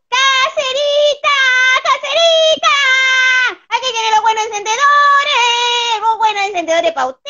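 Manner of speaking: high shrill market-seller cry. Acted. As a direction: call out like a shrill street vendor. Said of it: "con esta voz chillona".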